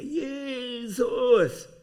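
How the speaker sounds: high, loud, long-held yell; shouted cry to God. Retold or imitated. Preacher re-enacts calling Jesus alone in the woods, high loud shout. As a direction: call out as loud as you can, re-enacted. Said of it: "so laut ich konnte".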